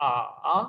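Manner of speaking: आ going low then rising back; third tone, dipping. Shown as a demonstration. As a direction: sink on आ, then lift it. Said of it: "third के साथ".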